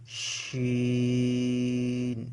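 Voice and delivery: শিন held long with a steady sh sound; drawn-out shin. Shown as a demonstration. Teacher showing students the letter শিন, drawn out. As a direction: stretch শিন slowly. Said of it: "এভাবে একটু".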